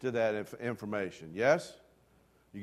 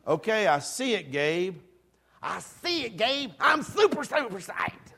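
mock convinced; 7 semitones higher, 3 dB louder than usual. Mock convinced reply to Gabe.

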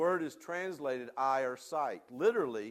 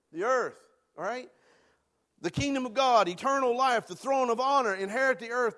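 rising, louder preached list of promises; preaching at full force; 6 semitones higher, 6 dB louder than usual. Acted. Preacher rallying the congregation, booming. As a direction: lift the congregation with each promise.

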